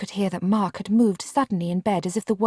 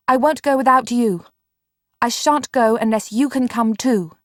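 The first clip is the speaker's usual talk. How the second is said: louder, firm and higher; emphatic; 4 semitones higher, 5 dB louder than usual. A narrator's reading. Marianne refusing to leave Mark, emphatic and louder. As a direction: insist on every word. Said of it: "and very emphatically".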